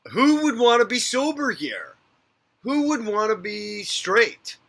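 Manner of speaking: exasperated rant. Real, not acted. Venting to viewers about sobriety, loud incredulous outburst. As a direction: throw the question up in disbelief. Ranting about staying sober.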